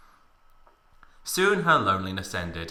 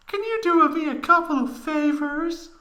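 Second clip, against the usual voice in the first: hidden excitement. Acted. Tifa starting a request to Cloud, coy falsetto.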